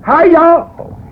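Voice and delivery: high, twangy Okie holler; Okie accent. Retold or imitated. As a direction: whoop it out like an Okie. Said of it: "He had that Okie accent".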